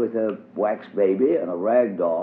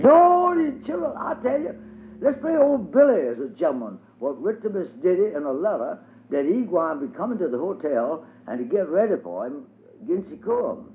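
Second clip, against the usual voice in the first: light up with a bright plan; excited idea; 8 semitones higher than usual. A narrator's reading. Dilsey tells the children her new game, high excited voice.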